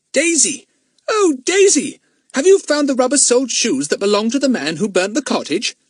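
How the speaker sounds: put-on very high, loud child's exclamation; thrilled schoolboy shout; excited cry. A narrator's reading. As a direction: cry out in delighted amazement. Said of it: "cried Fatty".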